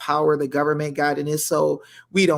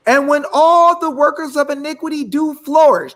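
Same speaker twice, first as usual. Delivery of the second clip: higher, louder preaching; building preacher cadence; 7 semitones higher, 4 dB louder than usual. Acted. Host preaching to viewers, rising in fervor. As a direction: rise into the verse like a sermon.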